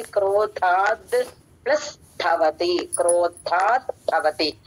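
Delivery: క్రోధాద్ said very slowly, the క్రో cluster pressed hard; క్రోధాద్ with a firm aspirated ధ, syllable by syllable; stressed conjunct kro. Shown as a demonstration. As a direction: lean on the క్రో of క్రోధాద్ then join ధవతి. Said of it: "క్రో, వత్తి పలకాలి".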